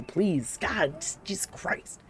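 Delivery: ordinary delivery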